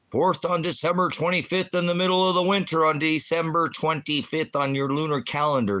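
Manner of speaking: loud, high-pitched rant, words tumbling out; ranting shout. Real, not acted. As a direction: yell it at the chat as if fed up. Ranting at chat about calendars.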